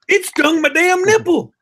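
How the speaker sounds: high, drawn-out mock outrage; outraged dad. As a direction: act out an indignant, hurting dad. Acting dad's outraged punchline.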